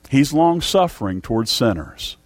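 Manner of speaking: normal talking voice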